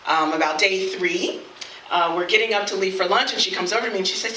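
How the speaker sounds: ordinary talk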